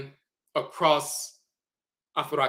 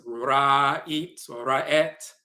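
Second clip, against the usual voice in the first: slow, each syllable apart, "Ra'it or Ra'et"; drawn-out read of "Ra'it or Ra'et"; slow demo; much slower than usual. Shown as a demonstration. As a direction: spell out "Ra'it" and "Ra'et" by sound, slowly. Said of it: "which is the T sound".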